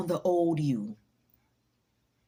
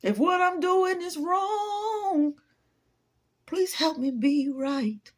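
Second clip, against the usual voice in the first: higher, very slow, put-on pleading voice; mock dramatic plea; 9 semitones higher, much slower than usual. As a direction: ham up a tearful confession. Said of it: "I play too much".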